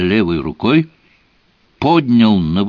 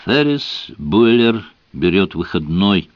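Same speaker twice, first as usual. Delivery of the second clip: usual speaking voice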